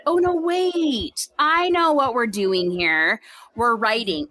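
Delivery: high, slow dawning surprise; a penny-drop moment, slow and high; sudden realization. Retold or imitated. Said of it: "your brain said".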